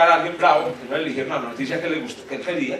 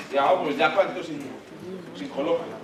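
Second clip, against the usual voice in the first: ordinary talk, nothing marked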